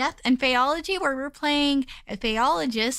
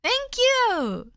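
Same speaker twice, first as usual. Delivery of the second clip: cute anime streamer; 9 semitones higher than usual. Acted. Thanking raider.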